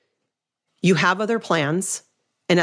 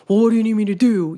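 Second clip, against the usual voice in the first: sharp, louder and higher, a bit rushed; defensive; 6 semitones higher, 5 dB louder, faster than usual. Retold or imitated. Husband snapping defensively at his wife's request. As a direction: snap back like a defensive husband. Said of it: "like defensive".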